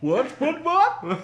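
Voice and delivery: hammed-up Patrick reply. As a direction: answer like a slow cartoon starfish. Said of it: "That's terrible".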